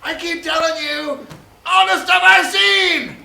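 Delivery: high, exasperated shout. Acted. R2-D2 nobody believes, exasperated high yell. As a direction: yell it slowly, at wit's end. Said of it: "He's like".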